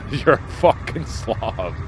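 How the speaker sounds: slow, deliberate imitation of the lady; slow mock scolding. Retelling the pooper clip, slowly imitating her insults. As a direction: spell out each insult. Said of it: "she says".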